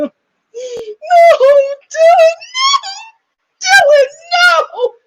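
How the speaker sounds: high, loud, slow mock wail. Aunt wailing over her crying nephew, high slow sob. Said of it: "the aunt was like".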